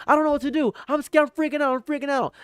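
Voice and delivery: very high, frantic squeaking; mock terrified whimper. Mocking his victims freaking out, very high and frantic. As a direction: squeal like a cartoon coward in a panic. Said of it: "struggling thinking".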